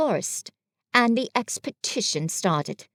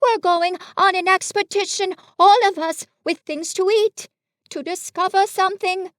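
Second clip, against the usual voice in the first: high, eager bear voice; excited; 9 semitones higher, 4 dB louder than usual. A narrator's reading. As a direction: spill the news excitedly, pitched well up. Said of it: "said Pooh excitedly".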